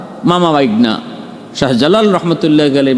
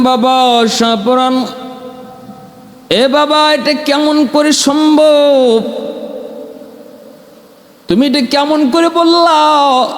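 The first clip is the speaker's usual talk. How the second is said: high, amazed disbelieving father voice; astonished father; 8 semitones higher, slower than usual. Retold or imitated. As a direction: ask it in stunned wonder. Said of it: "বাবা অবাক হয়ে গেলেন".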